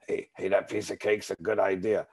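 put-on voice of a food tempter; Richie. Disease tempting the speaker with cake, in an imitated voice. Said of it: "love my disease to have Richie's voice".

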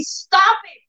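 high sharp rebuke; firm command to the enemy. Shown as a demonstration. Preacher showing how to rebuke the enemy firmly. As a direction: order the enemy to stop. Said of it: "you also tell the enemy".

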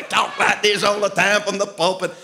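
put-on Southern pulpit voice, high and loud; Kenneth Hagin. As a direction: drawl it like a Southern preacher. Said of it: "He listened to Kenneth Hagin all the time".

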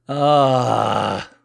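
loud, high drawn-out groan; frustrated yell. Acted. Man pushed down while standing up, loud frustrated groan. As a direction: cry out in exasperation. Foot pushed on his shoulder.

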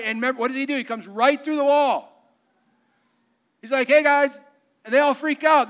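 loud, driving preacher voice; revival-style loud proclamation; full-force preaching. Acted. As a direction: preach it out to the whole room.

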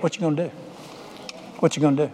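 low, soft inner voice; creeping worry. Acted. Nagging thought comes into your head, low and soft. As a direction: slip it in low and hushed.

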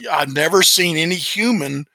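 ordinary talk

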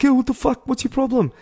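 high-pitched irate challenge; angry confrontation. Retold or imitated. Driver to runner he almost hit, acted angry challenge. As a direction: imitate an irate stranger calling out.

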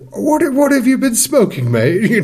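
very high, baffled British teacher; incredulous Brit. Retold or imitated. Teacher reacts to the kid's sewage dream, very high and baffled. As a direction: squeak out the disbelief. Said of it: "And he's like".

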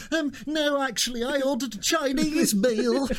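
high-pitched mock-polite customer; flustered customer. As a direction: squirm through a hammed-up polite correction. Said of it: "it was a proper, like".

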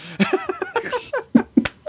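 sudden high laughing burst; burst of laughter. Real, not acted. Real laugh at own story.

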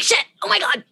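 high-pitched startled yelp of dismay; retold panic. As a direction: act out being caught unready, voice jumping up. Caught unready as he arrives.

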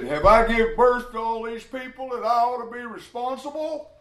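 high, strained cry of complaint; Moses crying out. Retold or imitated. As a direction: wail it up high like an overwhelmed leader.

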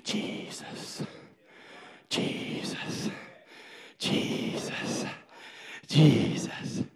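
slow, breathy whispered name; whispered prayer. Retold or imitated. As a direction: whisper it like a frightened child praying. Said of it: "If you ever get afraid, say".

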